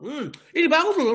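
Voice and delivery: hammed-up eager listener; mock gullible excitement. Preacher mocking a gullible listener, gushing loudly.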